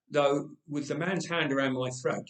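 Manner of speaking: usual speaking voice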